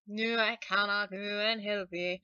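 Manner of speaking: put-on grandmother's line restarted cleanly in a Scottish accent. Singer voicing the selkie's grandmother, Scottish accent. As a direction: get the Scottish line right this time. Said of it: "she has to have a Scottish sort of accent".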